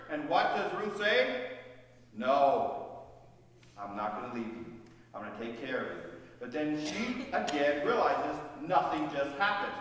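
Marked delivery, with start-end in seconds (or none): 2.1-6.0 tender refusal. Acted. Ruth refusing to leave.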